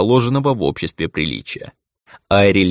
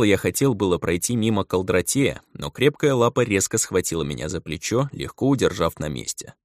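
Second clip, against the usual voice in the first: usual speaking voice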